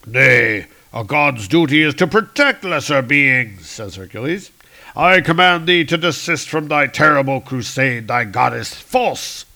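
loud, firm archaic command; commanding Hercules. Acted. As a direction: command them to stop.

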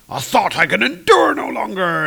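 mock furious thunder god line; angry Thor. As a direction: blurt it out as an enraged god. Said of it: "Thor is now really pissed".

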